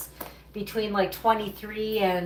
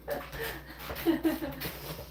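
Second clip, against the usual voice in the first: breathy laugh; 7 semitones higher, 7 dB quieter than usual. Real, not acted. Laughing at a chat comment.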